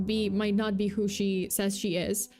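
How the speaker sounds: ordinary talk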